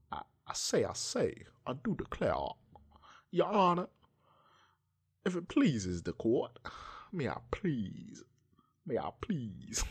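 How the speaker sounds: hammed-up, high-pitched cartoon Southern lawyer; Foghorn Leghorn impression. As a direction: plead grandly with swooping Southern pitch. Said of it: "frog horn, leg horn impression".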